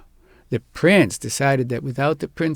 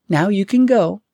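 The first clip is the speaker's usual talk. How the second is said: higher, louder mocking echo of the prince; princess parroting; 7 semitones higher, 5 dB louder than usual. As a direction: repeat it back like a parrot. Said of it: "repeated the princess after him like a".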